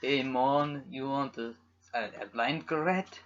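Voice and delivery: soft, tentative attempt at a Jamaican accent; badly faked Jamaican accent. Shown as a demonstration. Podcaster entertaining listeners with bad accents, poor Jamaican accent. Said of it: "This is my Jamaican accent".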